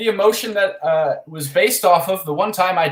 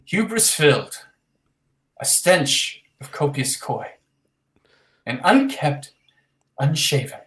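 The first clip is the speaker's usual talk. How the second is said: nervous open mic poet. Acted. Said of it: "this poem is called".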